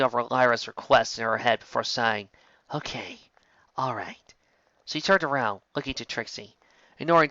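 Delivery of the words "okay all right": soft, half-whispered giving in; resigned sigh. Acted. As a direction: sigh and give in quietly. Sighing resigned agreement.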